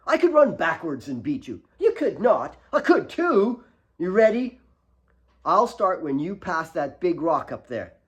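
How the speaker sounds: put-on bossy little girl. A narrator's reading. Kate boasting to Alex before their race, loud and bossy. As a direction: challenge him like a cocky nine-year-old.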